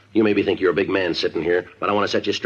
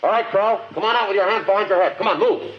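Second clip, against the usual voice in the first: shouting orders. Acted. Ordering suspect out.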